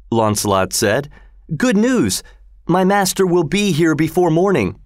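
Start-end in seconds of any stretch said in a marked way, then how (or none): 1.6-4.8 excited. A narrator's reading. Launcelot bursts in with news.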